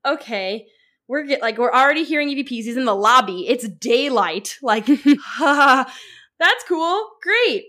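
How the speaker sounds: hammed-up fake delight, rising to high squeals; mock excited. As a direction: gush sarcastically about the ghost voices. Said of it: "So I'm like".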